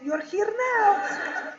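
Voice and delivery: put-on loud, drawn-out Russian accent; mock Russian dad, high and slow; Russian dad chiming in. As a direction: call out slowly as a Russian father. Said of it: "then my dad chimes in".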